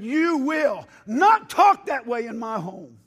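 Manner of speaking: slow, loud, stern shout; retold shout. Pastor telling prejudiced visitors off, shouting slowly. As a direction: lay down the law in your own house. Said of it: "and I said".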